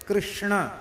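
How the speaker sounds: careful "कृष्ण", every consonant sounded; deliberate Sanskrit "कृष्ण"; correct pronunciation. Shown as a demonstration. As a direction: say "कृष्ण" as krish-na, retroflex. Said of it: "ये शुद्ध उच्चारण है".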